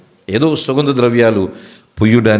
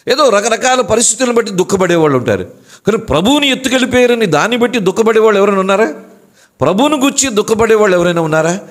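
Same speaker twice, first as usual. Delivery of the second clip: much higher, fast, pressing questions; preaching at full force; 10 semitones higher, faster than usual. Acted. Preacher challenging the congregation, fast and high-pitched. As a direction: challenge the crowd, driving faster.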